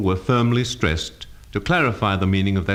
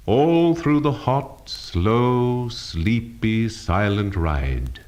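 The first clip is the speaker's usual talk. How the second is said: slow, drawn-out, drowsy line; lengthened words; much slower than usual. Shown as a demonstration. Teacher showing emphatic colour by length, lengthened words. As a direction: stretch each word, easing down to the end. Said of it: "By length".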